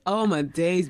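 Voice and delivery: ordinary talk, nothing marked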